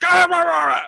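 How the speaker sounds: high, loud sports roar; mock fan roar. Roommate cheering at the Steelers game, loud roar. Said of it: "I would either be like".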